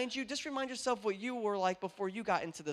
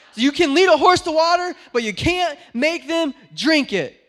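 much louder, higher exasperated yell of the saying; mad outburst; 8 semitones higher, 15 dB louder than usual. Retold or imitated. Preacher replaying his angry words to grandma, hammed-up yell. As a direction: hurl the proverb out, fed up. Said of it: "That's how mad I was".

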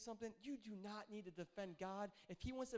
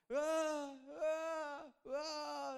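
put-on higher, louder baby wail; imitated baby cry; 7 semitones higher, 5 dB louder, much slower than usual. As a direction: wail like a hungry toddler. Imitating baby crying at night.

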